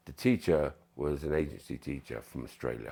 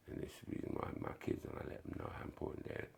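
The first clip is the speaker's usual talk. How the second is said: whisper; 13 dB quieter, faster than usual. Retold or imitated. Retold interview answer, whispered.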